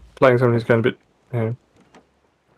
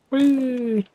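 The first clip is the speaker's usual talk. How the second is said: playful whee; 12 semitones higher, 5 dB quieter than usual. Pretend. Gliding across the top.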